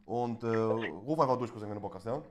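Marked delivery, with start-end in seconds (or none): none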